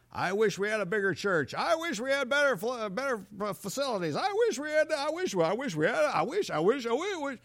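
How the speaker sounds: a high, nagging whine; whiny wishing. Acted. Mocking a grumbling churchgoer.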